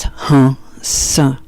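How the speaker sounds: two breathed syllables, the second whispery; mantra on the breath. Shown as a demonstration. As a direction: let each mantra syllable ride a breath. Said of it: "And the other way around".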